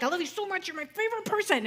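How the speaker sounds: high, loud gushing declaration; mock yell. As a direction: pretend to yell your affection at full volume. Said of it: "said it at the top of your lungs".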